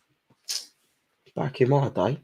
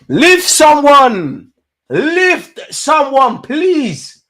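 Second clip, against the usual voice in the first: very slow, higher and louder, stretched begging; drawn-out plea; 10 semitones higher, 7 dB louder, much slower than usual. Real, not acted. Fan begging a player to lift someone, slow loud plea. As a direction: drag out each word, pleading loudly. Begs player to tackle hard.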